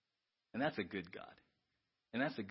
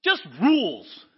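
dismissive rule-talk; 11 semitones higher, 15 dB louder than usual. Retold or imitated. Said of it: "we're like".

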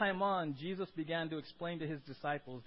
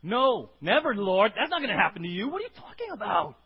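loud protesting outburst; Peter rebuking; 3 semitones higher, 10 dB louder than usual. Pretend. Peter pulls Jesus aside, loud indignant protest. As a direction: push back hard, can't believe it. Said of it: "here's what he did".

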